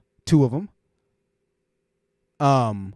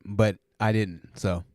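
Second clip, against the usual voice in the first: ordinary talk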